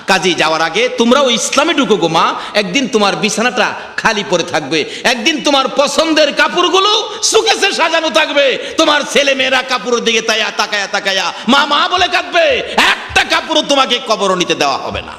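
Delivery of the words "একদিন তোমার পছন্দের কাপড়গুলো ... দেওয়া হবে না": high, pleading sermon voice; mournful waz plea; fervent preaching. Acted. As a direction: plead with the crowd from the pulpit. Preacher's emotional appeal.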